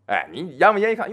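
ordinary delivery

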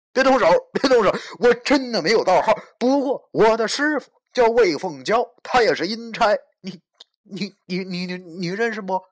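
fast, loud, high babbling plea; frantic pleading. Acted. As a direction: gabble desperately, then stammer. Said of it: "我连忙对他胡乱说道".

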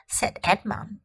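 usual speaking voice

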